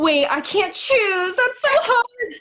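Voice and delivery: very high, drawn-out comic plea; playful agonizing. Real, not acted. Asked for her one dream role, playfully agonizing.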